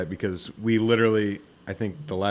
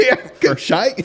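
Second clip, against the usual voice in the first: "Couché" drawled slowly in a hammed-up Australian accent; slow, high, loud mock Australian "Couché"; 11 semitones higher, 8 dB louder, slower than usual. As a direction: say "Yeah, Couché" like a joking Aussie, higher and louder. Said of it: "Now we're Australian".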